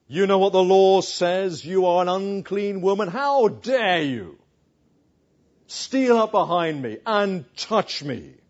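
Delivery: loud stern scolding; mock rebuke. Preacher imagining Jesus loudly rebuking the woman. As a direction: scold her as the law would. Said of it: "Is he gonna shout at her? Humiliate her?".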